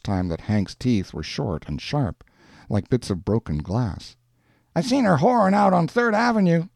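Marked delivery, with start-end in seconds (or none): 4.7-6.7 high-pitched street tough; provoking neighbour. A narrator's reading. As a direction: goad him in a raised character voice. Hank provoking Schultz in story.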